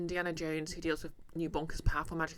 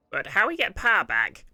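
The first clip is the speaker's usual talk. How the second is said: loud, puzzled goblin voice; confused goblin; 3 semitones higher, 12 dB louder than usual. Acted. Goblin asking how to regain power, loudly confused. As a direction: ask it loudly as a baffled goblin.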